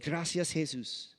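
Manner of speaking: soft imitated Spanish accent. Homeless-looking man thanking Jesus for money, Spanish accent. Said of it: "in his Spanish accent".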